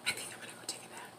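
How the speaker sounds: quick, very soft whisper; hushed, hurried aside. Real, not acted. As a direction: whisper it fast, as a private confession. Whispered aside about napping.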